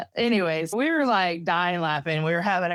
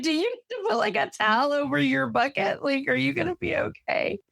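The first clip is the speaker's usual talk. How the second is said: higher, laughing through the offer of a towel; half-laughing mock worry; laughing concern; 8 semitones higher than usual. To her queasy friend at the detox, laughing concern.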